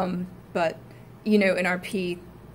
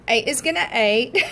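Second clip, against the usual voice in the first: laughing Cockney quote, higher and louder; Cockney Eliza; 5 semitones higher, 8 dB louder than usual. Retold or imitated. Student quoting a favorite meme, laughing Cockney. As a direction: play Eliza with a grin. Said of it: "Eliza, saying".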